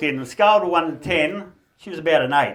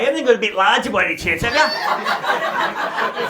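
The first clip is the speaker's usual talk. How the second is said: fast, higher, laughing ask; cheeky quick question; 5 semitones higher, 4 dB louder, slower than usual. Retold or imitated. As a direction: rattle it off to freak out the staff. Cheeky question at the change room.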